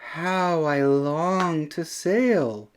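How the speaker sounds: lightly voiced wistful snail; tiny snail voice. A narrator's reading. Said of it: "said the tiny snail".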